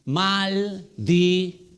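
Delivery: maldición drawn out very slowly; slow stressed word. Shown as a demonstration. Preacher naming the Old Testament's last word, very slow. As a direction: linger on maldición. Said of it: "La última palabra".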